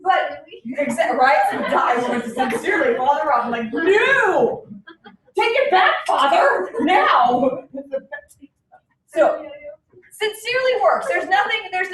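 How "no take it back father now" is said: mock outraged protest. Teacher retells protest to priest.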